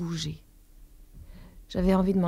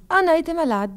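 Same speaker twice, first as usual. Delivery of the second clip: airy; 8 semitones higher, 4 dB louder than usual. A narrator's reading. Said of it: "dis-je d'un air léger".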